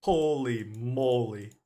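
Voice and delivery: slow drawn holy moly; amazed. Real, not acted. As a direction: awestruck then laughing. Amazed at case result.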